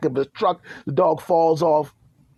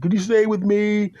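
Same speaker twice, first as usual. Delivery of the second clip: whiny plea; 8 semitones higher than usual. Acted. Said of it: "he said".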